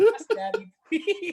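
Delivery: high, mocking girly plea; mock girl. Mocking the slapped girl's plea in a high girly voice. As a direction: purr it as a mocking tease.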